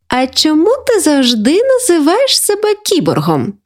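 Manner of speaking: put-on high-pitched girl's voice; curious granddaughter. Granddaughter asking grandma about cyborg nickname, high and curious. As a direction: ask with childlike puzzlement.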